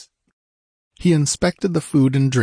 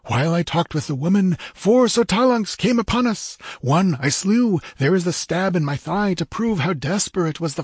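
high, excited warrior voice; high-pitched tribesman relishing his tale; Techotl's eager boasting; 11 semitones higher than usual. Acted. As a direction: recount the battle proudly, pitched high.

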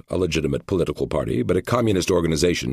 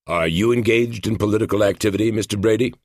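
questioning agent; 5 semitones higher than usual. A narrator's reading. Narrator voices questioning agent.

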